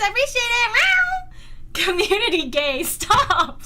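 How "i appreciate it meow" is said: high, squeaky thank-you ending in a meow; playful kitten-like squeal; cutesy thanks. Pretend. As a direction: pitch it way up, cute and silly.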